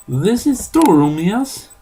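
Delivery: put-on high, loud Eastern European accent; thick Eastern European accent. A narrator's reading. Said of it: "in a thick Eastern European accent".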